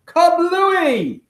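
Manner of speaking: plain reading voice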